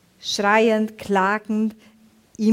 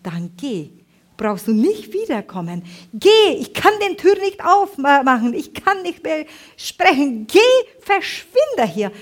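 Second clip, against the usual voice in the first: sharp rising outburst, much higher and louder; angry sister; 6 semitones higher, 5 dB louder than usual. Retold or imitated. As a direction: chase her sister away in anger. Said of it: "ganz verärgert und sagt".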